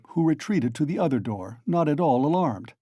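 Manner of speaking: ordinary delivery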